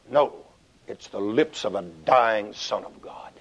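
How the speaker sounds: usual speaking voice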